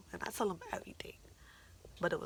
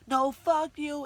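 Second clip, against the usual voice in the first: high, loud mock yell; reenacted furious outburst; imitated screaming; 8 semitones higher, 12 dB louder, slower than usual. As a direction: scream it back like the angry boyfriend. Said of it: "he's legit just screaming".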